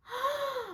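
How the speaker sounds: quick high intake of breath; pretend delighted gasp. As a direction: gasp as if thrilled by the caller. Reacts to the pretend caller.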